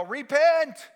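sudden high proclamation; bold call to repent. Acted. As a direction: call it out like a street preacher. Example of a bold public call.

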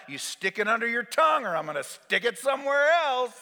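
acted no-nonsense nurse; bossy threat. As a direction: threaten the stubborn patient. Acted wife threatening patient.

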